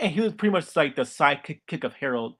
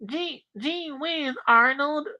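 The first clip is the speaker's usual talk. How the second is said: high, slow southern drawl; put-on southern cartoon voice, pitched way up; Stinky; 12 semitones higher, slower than usual. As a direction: stretch it out like a country kid from the South. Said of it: "that's his accent".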